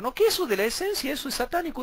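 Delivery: high mocking dismissal; mock scoffing; mocking opponents.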